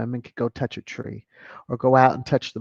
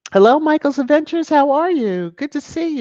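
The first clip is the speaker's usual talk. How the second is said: high, louder, fast hello; excited greeting; 12 semitones higher, 7 dB louder than usual. Real, not acted. Streamer greeting a regular in the chat, excited. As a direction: greet a friend with delight.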